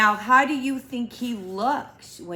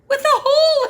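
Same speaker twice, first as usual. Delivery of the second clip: much higher and fast; high reveal; 8 dB louder, much faster than usual. A narrator's reading. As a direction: squeak it out in a high quick voice.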